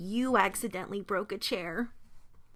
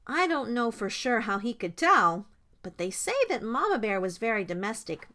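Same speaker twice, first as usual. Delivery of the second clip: plain reading voice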